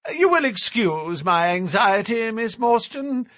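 high, airy and offhand. A narrator's reading. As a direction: toss it off lightly. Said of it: "he remarked airily".

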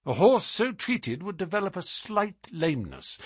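plain reading voice

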